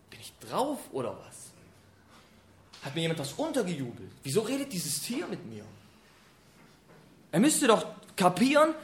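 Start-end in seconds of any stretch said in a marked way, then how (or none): 0.1-5.6 soft, fast, dazed mumble; bewildered modern guy. Retold or imitated. A modern man wonders if he's been drugged, dazed. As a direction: sound like someone who thinks he's tripping. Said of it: "heute würden wir wahrscheinlich so".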